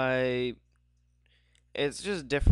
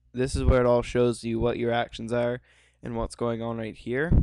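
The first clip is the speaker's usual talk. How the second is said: ordinary delivery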